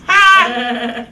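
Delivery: one sudden loud bark of laughter. Real, not acted. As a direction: let out a single burst of laughter. Host laughs at Kroger joke.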